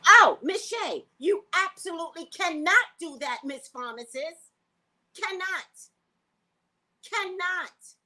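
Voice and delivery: high, slow scolding; mock scolding of the pharmacist, high and emphatic; scolding lecture. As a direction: wag a finger at the pharmacist.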